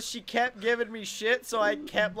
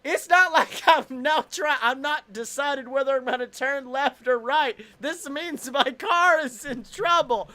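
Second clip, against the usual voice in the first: high, loud comic outrage; retold exasperated shouting; 6 semitones higher, 8 dB louder than usual. Stalled driver to honking old lady, exasperated mock yelling. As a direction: shout it as if explaining to an idiot.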